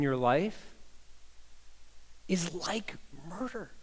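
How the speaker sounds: slowing, fading into a whisper; hushed to a whisper. Acted. Pastor hushes his congregation to a whispered point. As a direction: draw them in and whisper the end.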